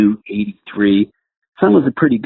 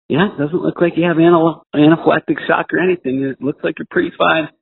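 higher-pitched imitation of an unimpressed paramedic; 8 semitones higher than usual. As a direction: voice a paramedic reassessing, pitched well up. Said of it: "the paramedics are like".

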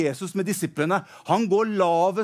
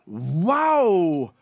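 amazed wow; 4 dB louder than usual. Acted. David sees the giant.

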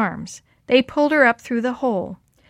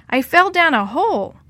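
high, raised call; yelling back; 7 semitones higher, 5 dB louder than usual. A narrator's reading. Girl calls to friends from down a hole, yelling back. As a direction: call up from a distance. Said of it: "she yelled back".

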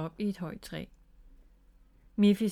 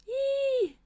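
very high, drawn-out squeal for help; frightened squeal; 10 semitones higher than usual. A narrator's reading. Miffy falls from the swing, frightened squeal. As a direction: squeal as if you are falling. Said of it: "skreg Miffy forskrækket".

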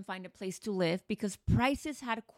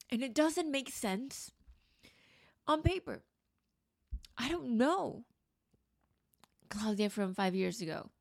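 holding back tears with a whispered catch; getting emotional; 4 semitones higher than usual. Real, not acted. Looking back on her past self, higher and near tears. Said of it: "oh God, I'm gonna get emotional".

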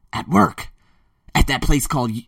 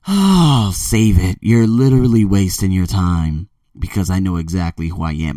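loud, curt brush-off, low-pitched; dismissive; 9 semitones lower, 4 dB louder than usual. Real, not acted. To doubters questioning him, a loud low dismissive brush-off. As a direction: wave them off, done listening.

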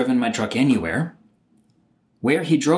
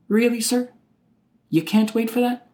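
incredulous sarcasm; 9 semitones higher than usual. Real, not acted. Incredulous retort to cop.

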